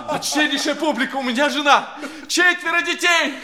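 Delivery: building from appeal to high loud wail; wailing public appeal. Acted. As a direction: cry out to the crowd, rising.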